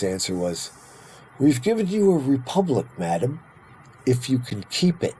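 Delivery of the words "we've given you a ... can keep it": Franklin. Retold or imitated. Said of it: "Ominous answer".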